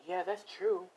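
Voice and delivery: high-pitched little creature voice; agreeing gingerbread man. Acted. Gingerbread man agreeing with Izuku, in a high voice. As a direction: chime in high and small.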